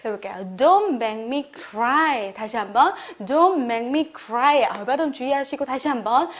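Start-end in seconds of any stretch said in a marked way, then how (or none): none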